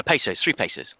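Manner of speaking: usual speaking voice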